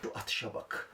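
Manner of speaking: hushed whisper. Real, not acted. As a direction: breathe it so nobody hears. Whispering during the throw. (fısıltı)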